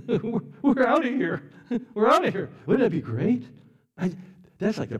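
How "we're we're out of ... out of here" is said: high, loud, through laughter; gleeful laughing. Real, not acted. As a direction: exclaim it between delighted laughs. Laughing joy at imagined rapture.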